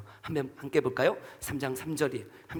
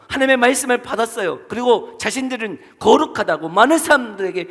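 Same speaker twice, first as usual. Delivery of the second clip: fervent preaching; 4 semitones higher, 7 dB louder than usual. Real, not acted. Preaching at full force.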